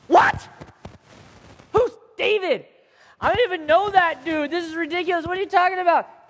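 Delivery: high, loud, indignant outburst; outraged grouch. Acted. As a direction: play an offended big bad guy, incredulous and scornful. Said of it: "reacted a little bit worse than that".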